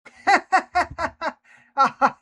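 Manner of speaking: sudden high, loud burst of laughter. Real, not acted. Streamer bursts out laughing at the story title, loud laugh. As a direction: crack up out loud.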